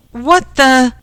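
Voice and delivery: high, loud, cut off in shock; startled exclamation. A narrator's reading. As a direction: blurt it out, stunned. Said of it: "exclaimed Ken".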